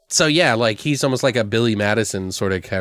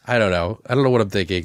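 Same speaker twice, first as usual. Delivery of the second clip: ordinary delivery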